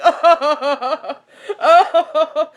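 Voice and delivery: high, hammed-up wailing sobs; mock sobbing. Telling viewers she cried doing dishes, mock high sobs. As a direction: imitate bawling, pitch up. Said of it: "I was, like, sobbing".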